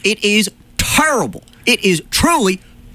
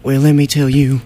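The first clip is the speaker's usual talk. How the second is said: ordinary delivery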